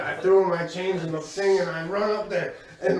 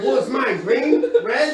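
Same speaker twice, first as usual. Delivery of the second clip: higher and louder, eager asking; mock eager question; 5 semitones higher, 5 dB louder than usual. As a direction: ask keenly which color you are. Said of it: "I'm like".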